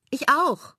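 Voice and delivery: high, loud eager call. Acted. Mela volunteering to sing with Bela, loud eager call. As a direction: blurt it out, volunteering at once. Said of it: "ruft Mela".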